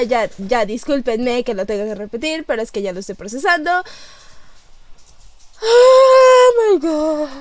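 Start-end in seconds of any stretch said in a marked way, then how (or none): 5.6-7.3 high, loud, very drawn-out wail; tearful amazement. Real, not acted. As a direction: stretch it out, overwhelmed to tears. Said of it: "Me estoy llorando". (lamento agudo y largo, llorando de emoción)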